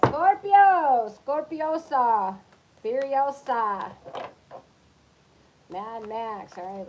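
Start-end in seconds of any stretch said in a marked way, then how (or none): 0.1-3.9 loud, slow, high showman call; dramatic announcer drawl. Pretend. Reader calling out Scorpio like an announcer, drawn out. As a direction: stretch the names out like a ring announcer. Playful sing-song calling the sign.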